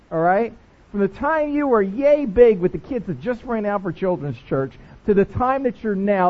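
ordinary delivery

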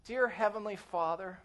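soft, low, mock-pious prayer; hushed formula prayer, lightly parodied; dutiful prayer. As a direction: put on a polite, dutiful praying voice. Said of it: "I go to prayer and I go like this".

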